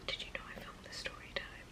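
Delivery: secretive whisper, barely voiced; whispered aside. Sister secretly asking her brother about the story time, whispered. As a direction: whisper it as a guilty confession.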